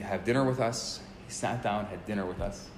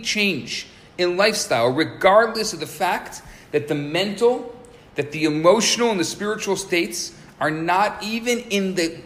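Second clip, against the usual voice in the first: loud, raised, deliberate teaching voice; emphatic preaching; 7 semitones higher, 8 dB louder, slower than usual. Acted. Rabbi teaching students about the soul's awakening, emphatic preaching. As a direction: drive each phrase home with force.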